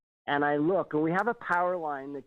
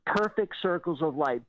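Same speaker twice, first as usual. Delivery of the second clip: ordinary delivery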